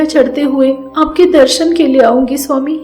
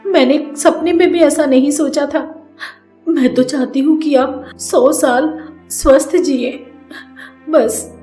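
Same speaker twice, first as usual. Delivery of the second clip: tearful pleading; 5 semitones higher than usual. Acted. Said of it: "Radha बोलती है".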